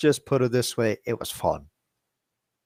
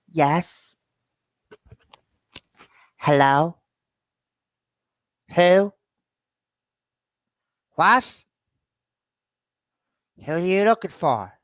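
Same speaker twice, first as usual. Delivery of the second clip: put-on higher-pitched old woman answering the phone; Agnes O'Leary voice; 5 semitones higher than usual. Answering a relative's phone call, old-lady Agnes voice. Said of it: "So I'm the one that does the voice".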